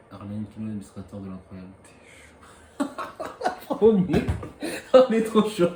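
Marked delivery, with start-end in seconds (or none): 2.8-5.7 loud, high, laughing cheer; excited laughing. Real, not acted. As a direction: celebrate the big pull. Celebrating rare card.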